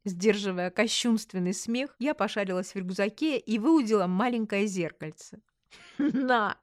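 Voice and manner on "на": short word escaping with a giggle; trying not to laugh; suppressed laughter. A narrator's reading. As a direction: blurt it higher through a held-in laugh. Hands over mirror, holding back laughter.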